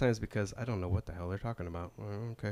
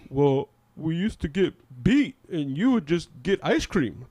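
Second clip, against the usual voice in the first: higher, louder mocking retort; teasing cousins voice; 6 semitones higher, 8 dB louder than usual. Cousins roasting her about ice cream, higher and louder. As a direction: rib her loudly.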